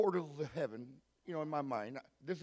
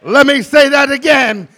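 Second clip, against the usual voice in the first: loud, driven sermon shout; full-force preaching; 7 semitones higher, 14 dB louder than usual. Real, not acted.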